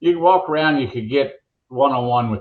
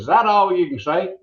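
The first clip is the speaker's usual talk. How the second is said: higher, fed-up mock burglar; the burglar snaps back at the bird, pitched up; hammed-up annoyed burglar; exasperated burglar; 7 semitones higher than usual. Burglar voice in told joke.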